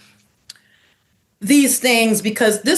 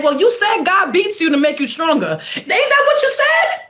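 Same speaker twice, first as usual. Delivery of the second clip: higher, accusing, tearful at the end; acted accusing daughter; 8 semitones higher than usual. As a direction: throw it back at the parent, near tears. Daughter accusing parent, voiced.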